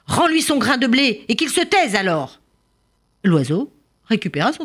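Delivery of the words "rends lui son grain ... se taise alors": king's shout. A narrator's reading. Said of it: "Il cria à son serviteur".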